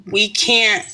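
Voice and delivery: usual speaking voice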